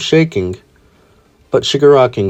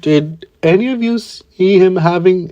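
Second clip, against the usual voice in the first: timid classmate voice; 7 semitones higher than usual. A narrator's reading. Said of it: "Koda would say".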